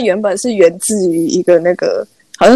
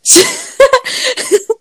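long laugh. Real, not acted. Laughing at the slang word.

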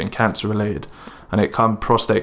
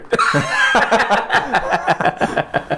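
laughing fit; 10 semitones higher than usual. Real, not acted. Laughs at own accent attempt.

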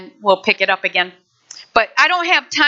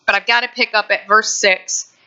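ordinary delivery

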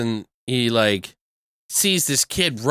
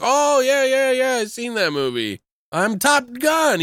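excited; 12 semitones higher than usual. Retold or imitated. Cop boasts about Top Gun.

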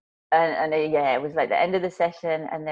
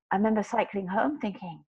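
normal talking voice

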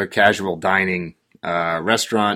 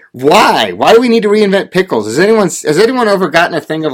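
higher, louder, exasperated why; appalled rant; 7 semitones higher, 4 dB louder than usual. Real, not acted. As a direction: vent the outrage at reinvented pickles. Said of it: "I was appalled".

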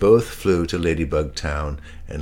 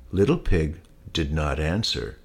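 ordinary delivery, nothing marked

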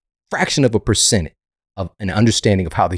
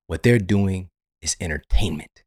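usual speaking voice

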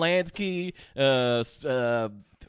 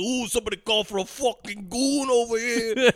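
higher, hammed-up Brooklyn gangster accent; mock Brooklyn accent; 5 semitones higher than usual. Podcaster showing friends the actor's awful accent, mock Brooklyn. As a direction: imitate a bad movie Brooklyn accent, amused. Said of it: "he's doing the Brooklyn accent".

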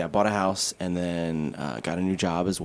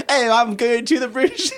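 a hammed-up bad British accent, much higher and louder through laughter; terrible British accent; 8 dB louder than usual. Shown as a demonstration. Laughing at her own terrible British bathroom line. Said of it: "like, terrible".

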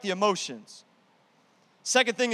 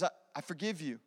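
quiet, softer forgiving words; soft forgiveness; 3 semitones lower, 11 dB quieter than usual. Acted. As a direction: forgive them gently. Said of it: "He says".

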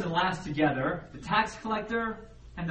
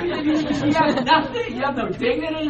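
worked-up desperation; 9 semitones higher, 8 dB louder than usual. Acted. Acting out a banished spouse begging.

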